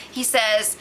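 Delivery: ordinary delivery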